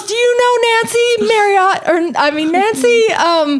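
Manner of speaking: high, hammed-up complaining voice; mock indignant wife. Retelling the ex-wife's complaint to husband, high mock indignation. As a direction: play the offended rich wife, pitched high. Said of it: "She goes".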